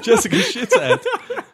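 high and loud, laughing through the name; can barely get the name out for giggling. Real, not acted. Cohost repeats the silly name, laughing.

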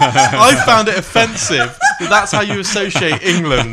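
half-joking outrage, giggling; laughing mock outrage. Real, not acted.

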